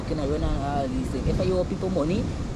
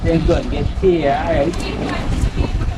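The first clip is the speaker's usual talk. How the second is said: loud send-off; 8 dB louder, much slower than usual. Acted.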